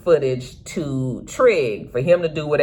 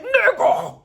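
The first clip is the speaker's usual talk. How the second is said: high, sharp yell of a name; shout. Retold or imitated. Duda yelling at Trig in surprise, high yell. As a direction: bark it out in outrage.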